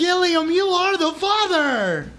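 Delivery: hammed-up TV host proclamation; Maury. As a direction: stretch it out into a mock paternity announcement. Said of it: "I'm not gonna pull a Maury".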